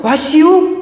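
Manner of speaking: "What you?" pitched high with a rising end; bright rising "What you?"; English-sounding pun. Retold or imitated. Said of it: "그 영어도".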